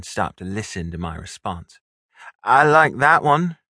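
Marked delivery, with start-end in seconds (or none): 2.4-3.6 loud, raised-pitch call. A narrator's reading. Stepbrother to stepsister in the washroom, calling out. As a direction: call it out so it carries. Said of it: "I called".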